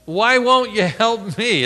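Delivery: high loud desperate plea; pleading cry. Retold or imitated. David crying out to God, loud and anguished. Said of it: "In other words".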